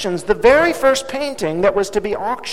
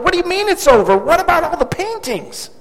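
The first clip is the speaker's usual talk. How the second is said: stunned buyer shouting at the auctioneer; shocked shout; 9 semitones higher than usual. A narrator's reading. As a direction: cry out in protest. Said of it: "someone shouted".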